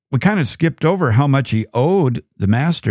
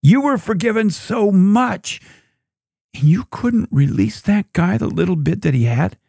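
distressed rebuke; 7 semitones higher than usual. Retold or imitated. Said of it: "I'd be distressed too. I say".

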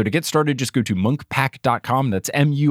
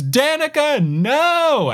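mock scream; much slower than usual. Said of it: "the guy in charge screams".

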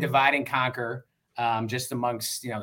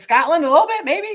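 put-on Scottish accent; 8 dB louder than usual. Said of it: "I'll do my best Scottish accent".

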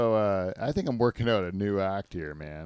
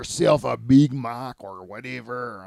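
put-on slow, louder gruff Québécois accent; mock Quebec accent; 3 semitones higher, 8 dB louder, slower than usual. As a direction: shoo the tourist away in a Quebec accent. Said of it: "Like that".

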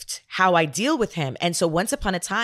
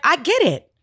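firm retort; 9 semitones higher, 5 dB louder than usual. Retold or imitated. Mom firmly answers her son.

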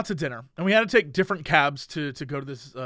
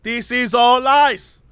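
lightly voiced ranting cab driver, higher; 7 semitones higher than usual. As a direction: imitate the driver's conspiracy rant. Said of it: "He's like".

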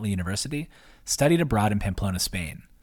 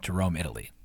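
ordinary delivery

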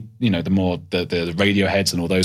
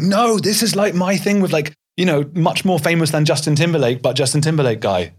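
excited pleading; 7 semitones higher than usual. Retold or imitated. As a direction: gush about it, pitch way up. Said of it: "I wanted to be like".

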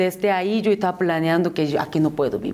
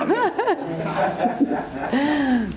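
high amused laugh; risa; 6 semitones higher than usual. Real, not acted. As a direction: laugh at the joke. Laughs at joke. (risa divertida)

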